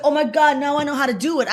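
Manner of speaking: high, loud eager gasp of insight; light up with a sudden idea; excited breakthrough. Retold or imitated. Rosario realizing how to audition, excited high voice. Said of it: "She's like".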